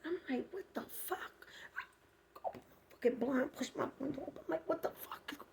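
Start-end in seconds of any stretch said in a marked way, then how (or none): 0.5-2.8 high unvoiced whisper; a shocked hushed what the fuck; whispered alarm at night; startled whisper. Real, not acted. 4.7-5.5 high unvoiced whisper; a shocked hushed what the fuck; whispered alarm at night; startled whisper. Real, not acted.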